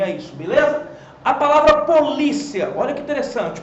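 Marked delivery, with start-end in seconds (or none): 1.8-2.7 polícia stressed on the middle syllable LI. Shown as a demonstration. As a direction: say polícia with a strong LI, ending in a quick glide. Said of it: "olha a diferença de você falar".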